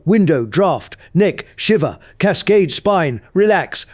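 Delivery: loud, forceful word list. Acted. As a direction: punch each word out firmly. Louder intense run of word list.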